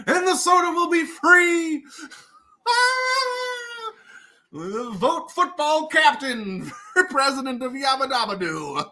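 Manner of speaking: high campaign shout through laughs; pandering politician. Retold or imitated. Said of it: "someone's like".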